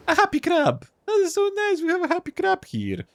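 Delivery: very high, gushing delight. Acted. Player cooing over a happy crab, delighted. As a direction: gush in a high, excited pitch.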